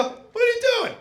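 high, exasperated dad yell. Retold or imitated. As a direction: shout like a fed-up father. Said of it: "just turn into your dad".

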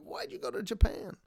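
put-on high, puzzled kid voice; mock kid asking why; nine-year-old self. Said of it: "I'm like".

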